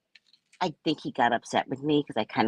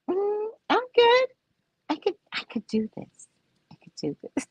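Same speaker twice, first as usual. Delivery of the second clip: fading nervous no-thanks; awkward polite refusal. Retold or imitated. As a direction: politely back away. Declining help with seatbelt.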